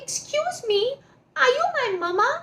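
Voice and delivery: high, pleading little bird; high bird voice asking the pig; baby bird voice. A narrator's reading. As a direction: chick voice asking hopefully. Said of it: "went up to the pig and said".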